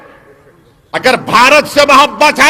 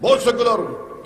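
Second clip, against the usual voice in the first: mocking quote; 5 semitones lower, 9 dB quieter than usual. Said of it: "اپنے آپ کو بولتا".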